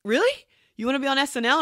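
high, surprised question. Retold or imitated. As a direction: ask in genuine disbelief. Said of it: "And he was like".

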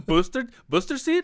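high, sweet put-on hostess voice; mock hostess offer. Hostess mocking a grown man's backwards jersey, in a high sugary voice. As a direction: offer the booster seat like to a child.

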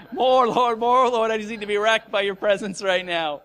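loud, pleading prayer; fervent plea. Retold or imitated. Preacher re-enacting his prayer to be wrecked, fervently. Acted tearful plea to God.

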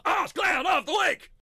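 high, loud mock announcer yell; big hammed-up shouted announcement; segment shout. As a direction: belt out the segment title.

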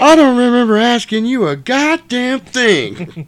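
high, loud, slow put-down; imitating Jules. Jules silences Marvin with a slow, loud, high put-down. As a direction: stretch every word, loud and high. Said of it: "and Sam Jack".

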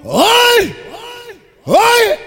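high shouted call. Real, not acted. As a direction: shout the crowd to attention. MC calling out to performer.

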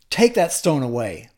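loud, firm order; firm command. Acted. Jesus at the tomb orders the stone moved, commanding. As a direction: order it with authority.

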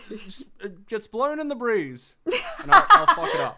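cracking up mid-line; laughing. Real, not acted. Laughing at own silly line.

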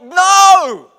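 loud, high shout of protest; passionate outburst. Retold or imitated. Pastor dad losing his temper at the match, shouted protest. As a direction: explode with outrage. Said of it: "But it was like".

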